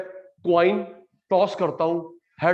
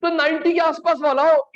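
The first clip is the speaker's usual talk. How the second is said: emphatic loud point; 10 semitones higher, 6 dB louder than usual. Real, not acted. Teacher stresses point loudly.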